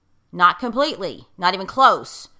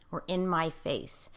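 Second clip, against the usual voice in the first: "Were in my face" repeated much softer, falling away; soft echo; 7 dB quieter than usual. Shown as a demonstration. Speaker echoing her own words to the guest, softly.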